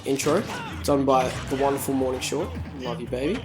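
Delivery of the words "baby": usual speaking voice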